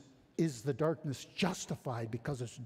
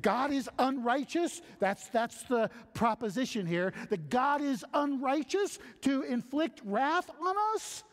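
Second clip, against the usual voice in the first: raised, high-pitched challenge of the objector; indignant objector; 9 semitones higher, 3 dB louder than usual. Acted. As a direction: push back like an outraged skeptic. Preacher voicing the objector.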